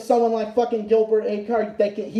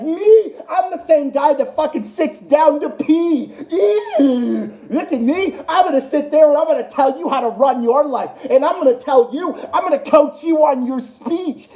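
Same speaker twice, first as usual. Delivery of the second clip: higher, laughing put-on voice of a prissy know-it-all; mock prissy voice; 3 semitones higher than usual. Streamer mocking Gilbert as a prissy nerd, higher laughing mock voice. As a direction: sneer through a giggle as the nerd.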